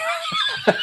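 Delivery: a high shrieking laugh that bursts out. Real, not acted. Co-host hears a retold line, shrieking laugh. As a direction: let a sudden high laugh explode.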